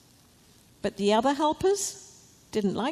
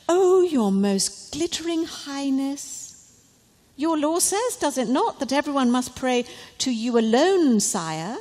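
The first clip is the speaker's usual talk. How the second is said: fawning courtiers; 5 semitones higher, 4 dB louder than usual. Acted. Bad men flatter the king.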